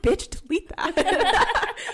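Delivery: high, loud, slow warning; alarmed. Retold or imitated. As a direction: blurt it out, half laughing. Quoting own alarmed reply.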